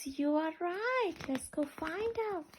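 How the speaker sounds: normal talking voice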